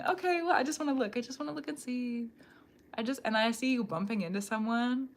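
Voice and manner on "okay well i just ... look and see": tiptoeing onlooker. Acted. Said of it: "I see you tiptoeing".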